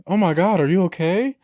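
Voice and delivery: mildly acted alarm and concern; retelling someone's worried surprise; retold startled concern.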